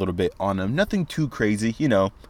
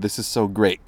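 usual speaking voice

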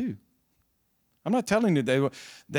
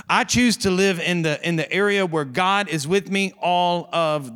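preaching at full force; 3 semitones higher, 4 dB louder than usual. Acted. Pastor rallying his church, loud rising preaching. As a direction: drive each "I choose" harder.

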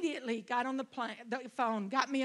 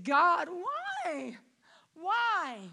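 crying out why, voice breaking; anguished cry; 6 semitones higher, much slower than usual. Retold or imitated. Preacher reliving grief, crying out to God. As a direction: plead to God through tears.

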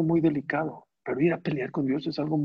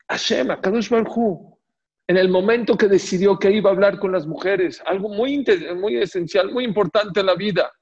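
emphatic preaching; 6 semitones higher, 5 dB louder than usual. Real, not acted. Preacher emphasizes God's tender speech.

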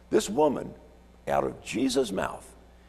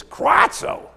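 high, loud squawked cry; unpleasant raven screech; 9 dB louder than usual. Shown as a demonstration. Preacher demonstrating the unpleasant sound from Peter and the woman, screeched. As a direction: screech it drawn out and harsh like a raven. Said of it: "are not pleasant to hear".

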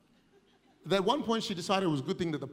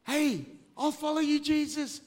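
lightly voiced eager young man; a hint of a keen young ruler; high mock-eager promise; rich young ruler; 9 semitones higher than usual. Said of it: "like the rich young ruler".

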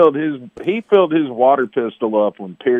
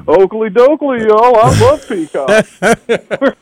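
high, very slow chirpy Flanders voice; mock Ned Flanders; 9 semitones higher, 5 dB louder, much slower than usual. Mocking the coach's tweet, high slow Flanders voice. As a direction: drag it out, cheery and high. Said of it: "he comes out with".

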